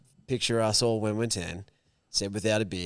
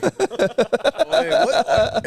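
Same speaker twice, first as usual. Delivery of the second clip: laughing fake surprise; 7 dB louder than usual. Acted confused wake-up, laughing.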